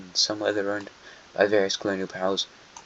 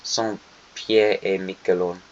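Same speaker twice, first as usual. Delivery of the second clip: slow, hammed-up French Saint Pierre et Miquelon; mock French accent; slower than usual. Shown as a demonstration. Map vlogger shows off his French accent on the islands, slow and mock-proud. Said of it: "My amazing French accent".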